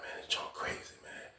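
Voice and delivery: awed, breathless whisper. Retold or imitated. Bystander telling himself the fight is crazy, whispered. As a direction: whisper it like you're afraid to be noticed.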